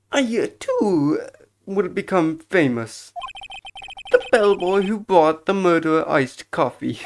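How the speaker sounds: proud, theatrical bellboy voice; obsequious bellboy. Acted. Bellboy dreaming of becoming famous, proud servile voice.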